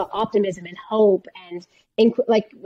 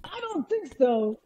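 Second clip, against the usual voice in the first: whiny genie; 6 dB quieter than usual. Pretend. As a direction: plead like a clingy genie. Continues the clingy genie bit.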